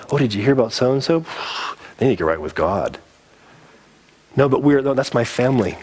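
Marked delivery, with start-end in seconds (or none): 0.1-3.0 gossipy. Retold or imitated. Mock gossiping churchgoer.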